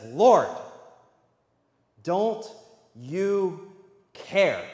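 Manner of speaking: loud sharp cry of Lord, then a halting, clipped question; hurt anger aimed at an absent helper; frustrated, angry plea. Retold or imitated. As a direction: Martha pleading angrily with Jesus. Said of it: "probably some anger in her voice".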